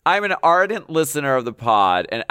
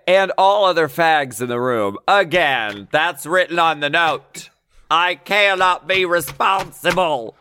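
bad Southern accent; 5 semitones higher, 3 dB louder than usual. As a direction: read the letter in a campy Southern accent. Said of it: "My southern accent is really offensive".

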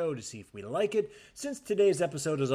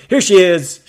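loud, amazed welcome; excited; 2 semitones higher, 11 dB louder than usual. Real, not acted. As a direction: greet her with big amazement. Greeting her co-host with exaggerated awe.

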